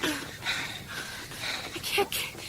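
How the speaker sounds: out of breath, words dragging; exhausted. Acted. Woman flagging on the hurried trek, breathless. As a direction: gasp it out, nearly spent.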